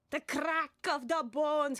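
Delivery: slow, drawn-out demonstration; emotional line reading. Shown as a demonstration. Director showing an actor how to lay it on, emotional reading. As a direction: lay it on, stretch the words slowly. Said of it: "You got any emotion?".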